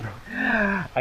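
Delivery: soft, high gasp; quiet intake of breath in dismay. Real, not acted. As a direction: gasp softly at the sorry sight. Dismayed at a struggling plant.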